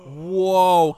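loud gasp then whoa. Pretend. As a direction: act stunned by the reveal. Mock amazement at the reveal.

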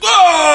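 high, long shouted "oh"; loud mock holler. Brother answering news of a solved case, loud mock holler. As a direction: holler a big drawn-out oh. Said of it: "and he's like".

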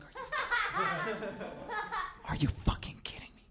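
breathless laughing whisper; high disbelieving whisper through laughter; incredulous. Retold or imitated. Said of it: "And I'm like".

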